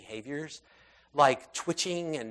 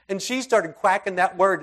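usual speaking voice

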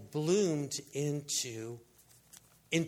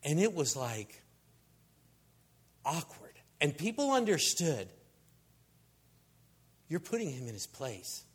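ordinary delivery

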